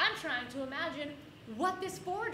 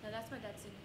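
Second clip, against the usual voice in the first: soft, innocent insistence; mock convincing denial; 6 semitones lower, 13 dB quieter, much faster than usual. Kid insisting to teacher, soft and innocent. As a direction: quietly claim it's your dad's. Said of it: "I give a convincing argument".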